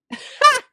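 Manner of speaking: one high, loud, sudden laugh; burst of laughter. Retold or imitated. Woman struck by the devotional she read, sudden loud laugh. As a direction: laugh out at the uncanny timing. Reacts to the devotional's fitting words.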